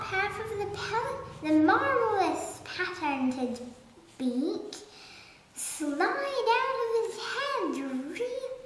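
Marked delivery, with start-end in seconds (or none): none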